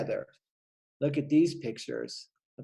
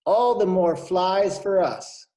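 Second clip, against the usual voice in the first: higher, louder, slow smug spider; gleeful spider; 5 semitones higher, 6 dB louder, slower than usual. Acted. Spider watching swallows leave, smug and slow. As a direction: savor it like a greedy spider.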